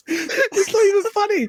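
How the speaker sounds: very high, slow squeak through laughter; helpless laughter. Real, not acted. Co-host laughing at talk of a dead uncle, helpless laughter. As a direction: squeeze the words out while cracking up.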